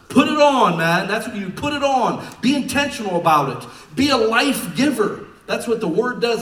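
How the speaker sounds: loud, high, driving preacher cry; full-force preaching. Acted. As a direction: shout it to fire up the church.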